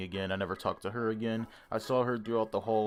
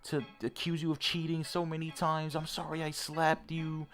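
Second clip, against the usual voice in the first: mock apologetic ex; 6 semitones higher than usual. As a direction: imitate her sorry, pleading tone, a bit higher. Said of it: "the whole fucking apologetic thing".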